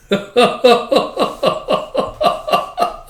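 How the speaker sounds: high breathy giggles; real laughing fit. Real, not acted. Reader cracking up at the confession, real laughing. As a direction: crack up in long wordless bursts.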